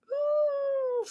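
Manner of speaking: long high whining sigh; acted sigh of dread; mock whimper. Mock dread of six weeks' holidays.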